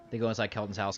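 ordinary delivery

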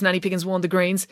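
usual speaking voice

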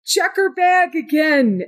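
high-pitched call from a balcony; mock yell down. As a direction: call it down without real volume. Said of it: "and yells down".

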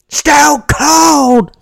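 huge announcer shout. Acted. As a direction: yell the name like a hype man. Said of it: "you said Stone Cold like that".

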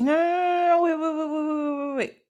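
high, run-together string of protests; mock desperate pleading. Granddad as Isis walks away, high mock desperation. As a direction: call them back in hammed-up panic. God calls back departing Isis.

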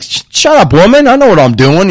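high, cocky shut-up to his wife; cocky brush-off. Retold or imitated. Husband brushing off his wife's warning, mock cocky. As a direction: wave her off, full of himself. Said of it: "And I'm like".

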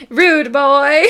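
put-on playful accusing rude boy; pointed rude boy. Shown as a demonstration. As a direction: jab the words at him. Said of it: "while pointing at him".

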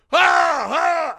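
high, loud hammed-up war shout; mock battle cry. Trapper jumping on the bear with his axe, yelling. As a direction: bellow it like leaping on a bear.

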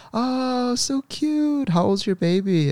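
put-on high cooing old-lady voice; cooing grandma. Uncle imitating grandmas cooing at the baby. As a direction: gush over the baby like a passing grandma. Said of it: "she's like".

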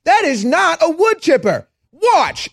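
high mocking voice of a stubborn denier; shrill-voiced parody of a denier; mocking stubborn liberal. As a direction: squeak it out like a smug idiot. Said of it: "they're like".

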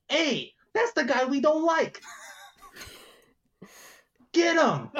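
put-on high-pitched kid calling out; kid in the crowd. Kid rallying the crowd against a guy, high yell. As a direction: pipe up like an indignant kid. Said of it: "from a single voice in the crowd, from the kid".

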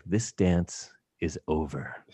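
low, slow, cold warning; stern cutoff. Retold or imitated. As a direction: end it flatly, no room to argue. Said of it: "grabbed me by the throat and was like".

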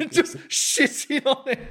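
laughing through the words, high and drawn out. Real, not acted. Podcaster cracks up retelling Kratos mocking Mimir, laughing. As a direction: barely get it out for laughing. Laughing over a game quote.

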